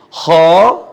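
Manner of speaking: Kha said with a full, rounded O-like vowel; thick kha. Shown as a demonstration. Teacher shows students the thick kha sound. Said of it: "Contohnya huruf kha".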